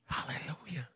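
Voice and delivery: breathy hushed praise; a soft whispered hallelujah after the shouting. Real, not acted. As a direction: let it fall to a whisper.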